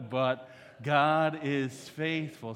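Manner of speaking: "But God is faithful" loud and stretched out; emphatic. Shown as a demonstration. Preacher giving the congregation their cue with "God is faithful", emphatic. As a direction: drive home "God is faithful", slowly and loudly. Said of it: "say it a little bit more emphatically".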